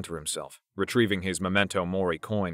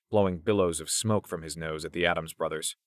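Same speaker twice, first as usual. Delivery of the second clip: usual speaking voice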